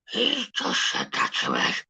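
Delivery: high, drawn-out mock outrage; mock offended complainer. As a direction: imitate someone who can't take a joke, slow and high. Mimicking people who take offence.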